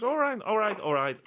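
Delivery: loud, high, repeated giving in. Retold or imitated. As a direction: cave in loudly, as if finally talked round. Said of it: "getting convinced".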